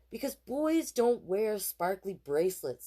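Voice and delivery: big sister laying down the rule; bossy sister. A narrator's reading. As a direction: lecture the little brother slowly. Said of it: "said Jessie".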